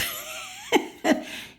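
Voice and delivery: a sudden high burst of laughter. Real, not acted. Laughing at her own worry about the weekend, high giggle. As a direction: let a squeaky laugh escape.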